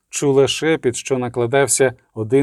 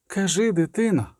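plain reading voice

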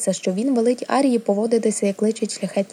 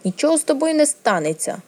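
higher-pitched, impatient rebuke; mildly sharp scolding, pitch raised; snapping; 7 semitones higher than usual. A narrator's reading. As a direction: tell off a fussing pet briskly. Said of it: "гаркнула вона на Німерію".

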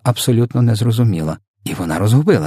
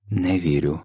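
low, soft mumble of disbelief; subdued muttering queen; muttered; 3 semitones lower, 6 dB quieter than usual. A narrator's reading. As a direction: mumble it to yourself, quietly. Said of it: "пробурмотіла королева".

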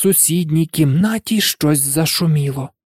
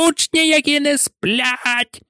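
shrill high screech, much higher; shrieking; 11 semitones higher than usual. A narrator's reading. Peeves shrieking to alert Filch about the pupils. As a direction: screech it to the whole castle. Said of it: "заверещав Півз".

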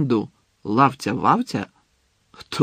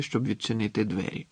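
plain reading voice

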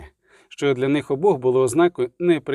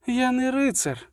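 high, drawn-out protest without much volume; indignant cry; 10 semitones higher than usual. A narrator's reading. Milva denying she is a knight, crying out. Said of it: "крикнула Мільва".

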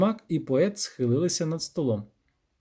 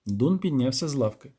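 ordinary delivery, nothing marked

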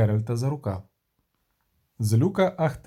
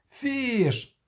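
sharp, much higher yell of a name; shout; 9 semitones higher than usual. Acted. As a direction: call him out across the water. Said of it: "крикнув Кобін".

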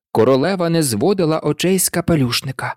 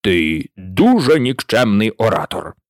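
lower, dismissive remark; mocking the hatter; low, contemptuous put-down; low scornful king; 6 semitones lower than usual. A narrator's reading. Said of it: "сказав король".

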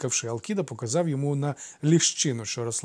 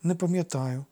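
higher-voiced sulky boy; sullen grumble; 6 semitones higher than usual. A narrator's reading. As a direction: grumble it gloomily. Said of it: "похмуро буркнув Алкід".

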